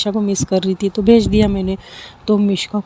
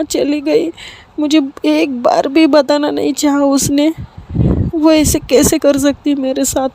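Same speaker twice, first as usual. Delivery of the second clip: much higher, tearful and hurt; sobbing complaint; 6 semitones higher than usual. Acted. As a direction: daughter crying to her mother about her friend, tearful. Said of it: "इतना रोने लगती है".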